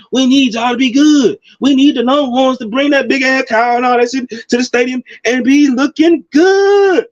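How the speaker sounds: rising excited demand ending in a shout; fired-up fan begging his team; hyped plea. Real, not acted. Pleading for Texas to return.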